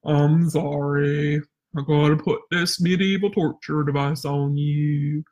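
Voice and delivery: mock sorry tone. Shown as a demonstration. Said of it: "If you're like".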